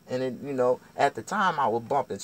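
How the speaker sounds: ordinary talk, nothing marked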